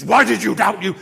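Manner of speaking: loud, furious accusation; angry rebuke. Acted. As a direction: thunder it like a stern judge. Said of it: "like, anger in Jesus".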